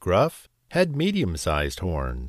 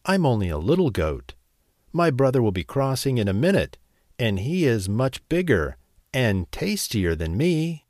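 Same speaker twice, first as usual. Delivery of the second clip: quick nervous talk. Acted. Frightened goat pleads.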